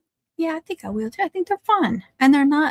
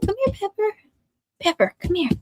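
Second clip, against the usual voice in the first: high pet-calling voice; 9 semitones higher than usual. Real, not acted. Calling dog to come.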